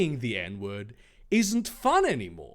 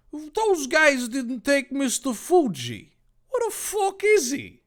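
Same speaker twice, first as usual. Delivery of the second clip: higher, slow-starting Dave line climbing very high; rising hero outrage; 9 semitones higher than usual. Acted. Dave reacting to the escape, high and rising. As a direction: build up baffled hero outrage.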